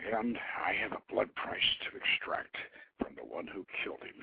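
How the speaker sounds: breathy whisper of a blood oath; whispered vow. Acted. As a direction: whisper it, cold and low. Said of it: "she whispered".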